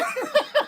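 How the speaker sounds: short high giggle; real laugh. Real, not acted. Joke teller teasing friends about the ending, laughing. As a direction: let out a high burst of laughter.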